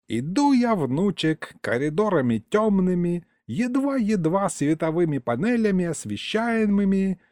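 put-on a rambling elderly grandfather, proud of himself; old grandpa storyteller. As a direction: tell it like a folksy old man, savouring every word. Grandpa's long folksy tale.

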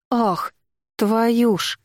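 резкий вскрик выше; ахнуть от боли. Acted. Порезалась ножом, вскрикивает. (вскрик от боли)

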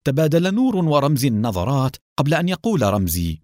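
plain reading voice

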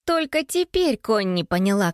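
ordinary delivery, nothing marked

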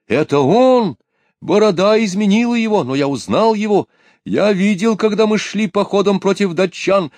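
high, loud eager recognition; excited. A narrator's reading. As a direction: blurt it out with joy. Soldier excitedly recognizes tsar.

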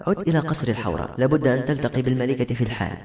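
plain reading voice

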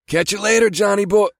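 high, loud, breezy sign-off; breezy teasing farewell. A narrator's reading. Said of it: "switched back to its usual breezy tone".

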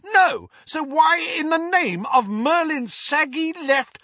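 put-on very high, loud teen rant cut off mid-curse; teen raging at his parents before being cut off; furious outburst. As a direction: rant it until you're stopped short.

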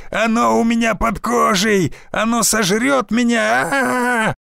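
high terrified screaming, slowed; panicked frantic pleading. Acted. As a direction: wail in mounting horror. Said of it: "истошно вопил". (истошный панический крик)

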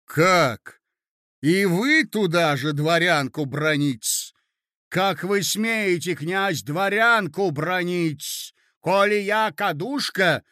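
indignant lady. Acted. Lady confronting her hostess over the sugar story, indignant. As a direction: take offence and stretch the key words.

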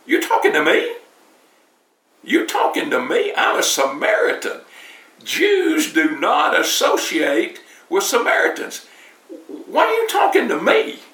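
high, incredulous woman's voice rising on the questions; indignant disbelief. Retold or imitated. The Samaritan woman answering Jesus, high and incredulous. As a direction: act shocked and offended that he spoke to her. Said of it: "I can just hear today the lady saying".

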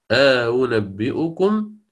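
slow drawn-out أنبئكم with rising end; recited pronunciation. Shown as a demonstration. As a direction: recite أنبئكم slowly, hamza clear. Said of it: "فيقرأ".

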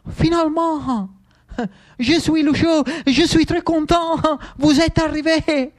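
high, eager French greeting; excited Lucio in French. Acted. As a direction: greet the general brightly, bursting with joy. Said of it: "Lucio non stava nei panni".

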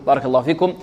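ordinary talk, nothing marked

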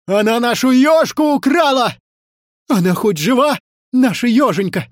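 high, urgent outcry; anxious, heated exclamation; agitated alarm. A narrator's reading. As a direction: cry out in worry for a stolen friend. Ivan frets over stolen hedgehog.

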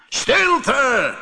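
high, bellowed command; roaring genie. Acted. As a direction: silence the fisherman with a roar. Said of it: "brulde de geest". (brullend bevel)

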